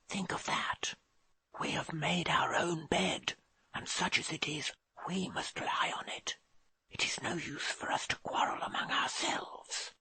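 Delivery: soft urgent whisper. A narrator's reading. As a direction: whisper it into his ear. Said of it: "whispering into his ear".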